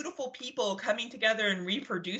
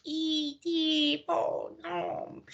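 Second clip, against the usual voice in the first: E.T; 9 semitones higher, much slower than usual. Retold or imitated. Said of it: "I was like".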